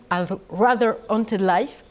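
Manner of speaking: the book title said in her own French-accented English. Real, not acted. Lecturer apologises for her English accent, then reads the title in it. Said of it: "mon accent anglais".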